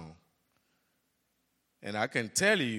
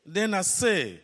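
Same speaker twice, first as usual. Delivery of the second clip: usual speaking voice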